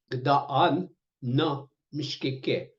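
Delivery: drawn out, syllable by syllable; very slow "Gdaan na mishkike"; slow teaching pronunciation. Shown as a demonstration. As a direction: say it slowly for learners. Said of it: "You can also say".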